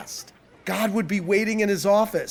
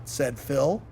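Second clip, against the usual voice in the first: usual speaking voice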